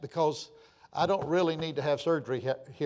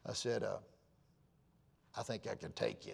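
quiet teasing challenge; 6 semitones lower, 12 dB quieter than usual. Retold or imitated. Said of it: "I come in and said".

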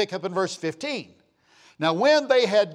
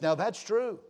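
ordinary talk

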